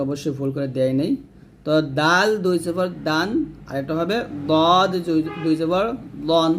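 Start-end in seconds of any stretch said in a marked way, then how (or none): 2.0-3.3 দাল with a plain light d, ending দান; dāl sound. Shown as a demonstration. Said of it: "তারপরে এখানে দাল হবে". 4.5-6.5 দদ heavy and rounded, ending দন; thick Arabic ḍ in দদ and দন; ḍād sound. Shown as a demonstration. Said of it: "আর এখানে দদ হবে".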